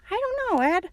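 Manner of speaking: put-on very high old-lady voice; high-pitched granny; grandma voice. A narrator's reading. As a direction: answer as a doubtful grandma. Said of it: "said the grandma".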